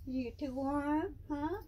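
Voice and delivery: high, slow cooing question. Real, not acted. Owner asking her python if he's too warm, slow and high. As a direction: ask it slow and sweet.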